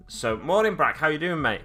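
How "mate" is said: ordinary talk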